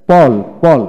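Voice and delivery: পল loud and distinct, twice; model reading. Shown as a demonstration. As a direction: drill পল loudly with an open "aw". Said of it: "আমি এখন পড়ে শোনাই".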